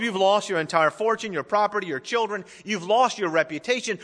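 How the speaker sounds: usual speaking voice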